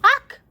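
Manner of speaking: sudden loud yelp of pain; sharp yelp. Real, not acted. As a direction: cry out as if burned. Burned by hot food.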